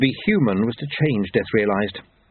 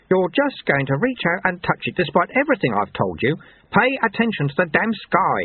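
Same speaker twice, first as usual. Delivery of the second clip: higher-pitched, scolding teacher; exasperated mentor; 7 semitones higher than usual. A narrator's reading. Lu-Tze warns Lobsang not to touch, exasperated and higher. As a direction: scold the pupil, pitch pushed up.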